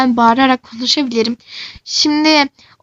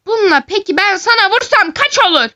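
mock threat; 7 semitones higher, 3 dB louder than usual. Threatening with hot curler.

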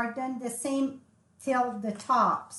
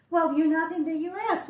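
higher, louder and fast, like a startled customer; surprised disbelief; 6 semitones higher, 6 dB louder, much faster than usual. Retold or imitated. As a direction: blurt it out in quick disbelief. Retold customer doubting her location.

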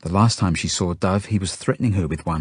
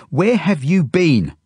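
high, loud, drawn-out demand; furious shout; 10 semitones higher, 6 dB louder, slower than usual. A narrator's reading. Genya confronts him as he comes home, furious. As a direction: scold him at full voice, slowly. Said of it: "She's furious".

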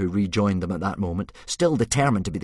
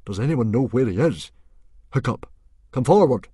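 loud, raised chief's voice; calling out; 6 semitones higher, 8 dB louder than usual. Acted. Stoic calls his son out of the crowd, loudly. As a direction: summon someone from a crowd.

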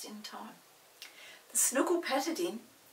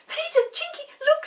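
excited call; 12 dB louder than usual. Acted. Molly calling excitedly.

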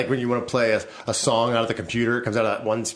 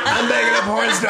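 mock boast; 9 semitones higher than usual. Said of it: "I'm like".

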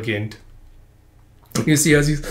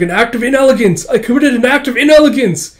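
horrified wail; 9 semitones higher, 6 dB louder than usual. Acted. Gentleman horrified at himself.

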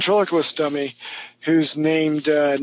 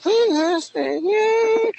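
squeaky ventriloquist dummy. Acted. Dummy voice lingering.

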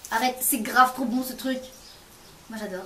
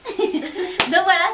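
laughing through the words; slow, laughing giggle; 6 semitones higher, 6 dB louder, much slower than usual. Real, not acted. Laughing at her reaction.

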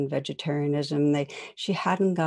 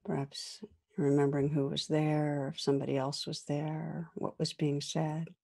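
guided reflection; 3 dB quieter than usual. Acted. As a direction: draw them gently into recalling a moment.

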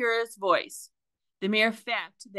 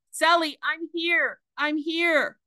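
higher-pitched call from inside the boat; 9 semitones higher than usual. A narrator's reading. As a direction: call up to be heard through the hull. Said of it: "called out".

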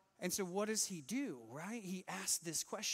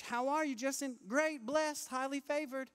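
high, loud churchgoer greeting; hammed-up fake church pleasantries; pretend cheerful; 10 semitones higher, 7 dB louder than usual. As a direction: put on an over-bright everything-is-great front. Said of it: "we would just pretend".

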